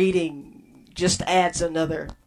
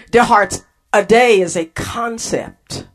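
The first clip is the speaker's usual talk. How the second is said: ordinary talk, nothing marked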